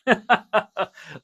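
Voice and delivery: a short high chuckle; amused laugh. Real, not acted. Laughing at the mom saying oh okay. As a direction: laugh at her surprise.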